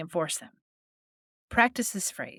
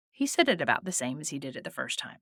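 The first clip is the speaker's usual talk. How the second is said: normal talking voice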